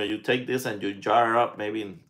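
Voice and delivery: ordinary delivery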